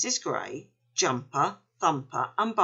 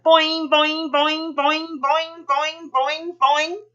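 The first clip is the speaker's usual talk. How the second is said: high, loud springy boings; bouncy boing; 10 semitones higher, 5 dB louder, slower than usual. A narrator's reading. Kangaroo bouncing, sound effect.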